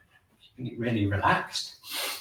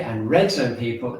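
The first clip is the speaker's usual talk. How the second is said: normal talking voice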